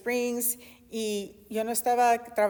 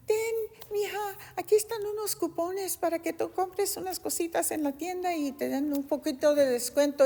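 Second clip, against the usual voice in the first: sweet grandmother voice; 7 semitones higher than usual. Retold or imitated. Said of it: "y me dijo".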